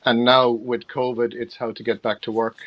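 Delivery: ordinary talk, nothing marked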